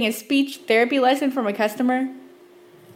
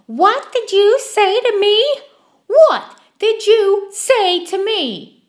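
much higher, louder, slow indignant repeat; shrill offended woman; 10 semitones higher, 7 dB louder, much slower than usual. Retold or imitated. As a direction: play the scandalized lady demanding an answer. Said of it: "with a shrill voice".

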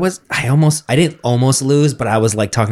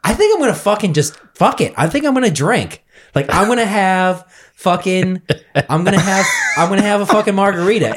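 much higher pitch, acting out giving in; retold impulsive outburst; 8 semitones higher than usual. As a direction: re-enact blurting out a reckless decision, pitched up high. Retells announcing he will drink.

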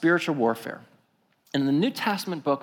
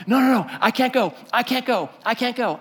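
imitating a panicked refusal, high and repeated; high-pitched mock panic; emotionally keyed up; 8 semitones higher than usual. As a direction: act out someone frantically backing out. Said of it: "he is emotionally keyed up".

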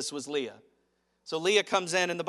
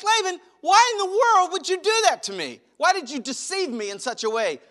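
much higher and louder, indignant; hammed-up wounded protest; upset Jacob; 13 semitones higher, 10 dB louder than usual. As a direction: demand an answer from the cheat. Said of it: "he's upset with Laban".

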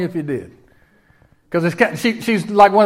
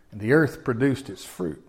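usual speaking voice

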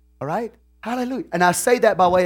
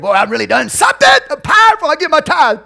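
high, loud, fast mock brag; smug boasting; 11 semitones higher, 9 dB louder than usual. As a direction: puff yourself up, proud of a tithe. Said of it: "we get this attitude".

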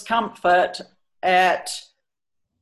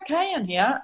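voicing the horse; 11 semitones higher than usual. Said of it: "And he's like".

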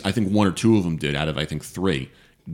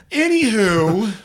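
sing-song anywho; 6 dB louder, slower than usual. Real, not acted. Sing-song topic change.

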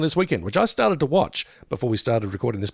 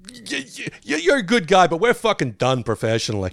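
stammering, high-pitched mock tirade; spluttering start, then a mock dismissal; Bale rant imitation; 8 semitones higher, 3 dB louder than usual. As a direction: imitate a star telling off the crew. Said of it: "if Bale yelled at you like that".

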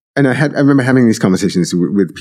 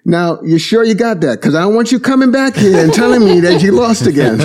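much higher, teasing Brooklyn Italian voice; Brooklyn Italian guy; 11 semitones higher, 3 dB louder than usual. Retold or imitated. Brooklyn truck guy teasingly warns a lost stranger, Brooklyn accent. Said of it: "very Brooklyn, New York, Italian guy".

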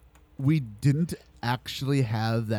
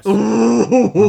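excited outburst; 8 semitones higher, 12 dB louder than usual. Real, not acted. Reacts to the Omori reveal.

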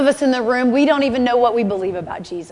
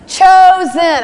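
a high, loud shout of the word; shouted declaration; 7 semitones higher, 7 dB louder than usual. Real, not acted. Preacher shouting to the congregation that they are chosen, loud and high. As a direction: belt it out to the room.

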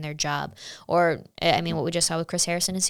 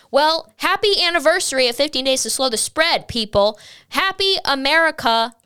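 higher, louder sarcastic celebration; mock cheer; 6 semitones higher, 6 dB louder than usual. Real, not acted. Sarcastically celebrating the lockdown anniversary to her viewers. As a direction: toast it with bitter mock cheer.

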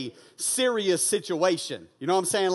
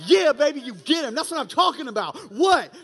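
proud cheering; 5 semitones higher, 5 dB louder than usual. Retold or imitated. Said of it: "the proudest moment of my life".

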